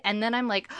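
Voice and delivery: ordinary delivery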